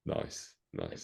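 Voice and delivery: soft whispered aside; whispering. Real, not acted. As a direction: whisper it under your breath.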